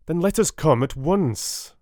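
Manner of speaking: high, urgent cry; cried out. A narrator's reading. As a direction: call out, pressing them to go. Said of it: "I cried".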